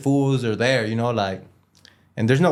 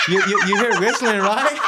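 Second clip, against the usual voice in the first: laughing through the words, pitched up; 10 semitones higher than usual. Real, not acted. Laughing as the friend asks to hear the whistle. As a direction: giggles while dodging the whistle request.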